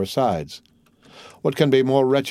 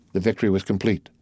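ordinary delivery, nothing marked

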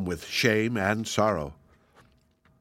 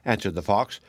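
ordinary delivery, nothing marked

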